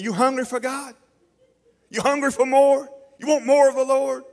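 high, loud call to the crowd. Acted. Preacher rousing the crowd, loud high call. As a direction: shout the question to the room.

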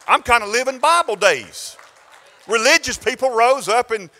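rising to a loud preaching cry; loud glory-to-God outburst; preaching exclamation. Real, not acted. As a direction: lift it up and praise loudly. Preacher exclaims mid-story.

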